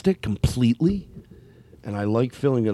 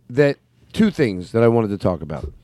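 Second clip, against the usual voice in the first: usual speaking voice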